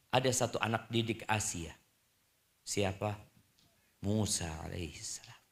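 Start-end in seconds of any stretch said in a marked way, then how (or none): 4.0-5.4 hushed whisper. Real, not acted. Preacher to congregation, answering his own question in a whisper. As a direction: whisper the name softly, barely voiced.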